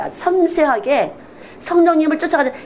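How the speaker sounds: usual speaking voice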